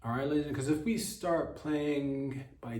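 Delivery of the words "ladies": ordinary delivery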